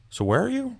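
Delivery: high-pitched imitation of a lost buddy; mock clueless friend. Retold clueless friend checking in by voice.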